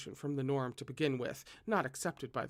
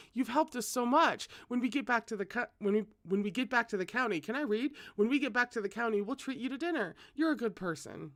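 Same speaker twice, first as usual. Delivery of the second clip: put-on eager young man; 7 semitones higher than usual. Cultivator voiced gratefully.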